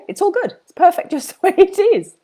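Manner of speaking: chirpy high reassurance with a giggle; laughing cheer. Real, not acted. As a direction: toss it off lightly with a laugh.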